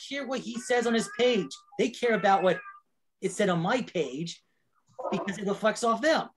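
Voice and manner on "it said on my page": ordinary talk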